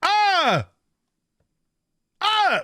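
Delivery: high yelps imitating the guy's scream; two short high screams; mock scream. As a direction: cry out like the man being burned. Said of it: "you just hear this, ... from the guy".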